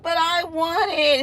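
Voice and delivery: high pleading child, lightly voiced; mock whine of a child wanting a pet; whiny kid voice. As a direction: beg like a little girl. Child begging, voiced by mom.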